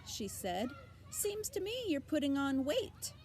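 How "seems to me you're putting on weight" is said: put-on high, bleating old ewe; nosy old sheep greeting. A narrator's reading.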